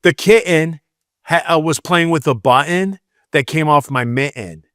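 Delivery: Michigan accent with swallowed T's; lazy Michigan speech. Shown as a demonstration. Showing lazy Michigan speech, T's dropped in kitten, button, mitten. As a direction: drop every TT, lazy Michigan style. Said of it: "lazy Michigan speech".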